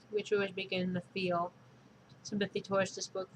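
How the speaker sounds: usual speaking voice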